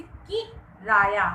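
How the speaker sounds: किराया slowly, with कि punched and set apart; stress on first syllable. Shown as a demonstration. Teacher giving pupils a second example, stressed first syllable. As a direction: hit कि, pause briefly, then Raya. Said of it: "किराया में क पर बल दिया".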